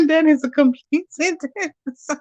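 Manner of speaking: slow words broken by laughter; laughing. Real, not acted. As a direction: laugh your way through it. Laughing at a comment.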